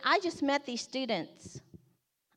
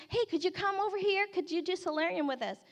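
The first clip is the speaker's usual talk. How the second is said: high, quick imitation of a girl asking; eager recruiting; 7 semitones higher, faster than usual. Girl running to friends to invite them, eagerly. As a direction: rush the invitation to friends in a higher voice.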